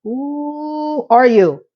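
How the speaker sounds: slow, drawn-out disbelief; incredulous. Retold or imitated. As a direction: stretch it out like he's an alien. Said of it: "I'm like".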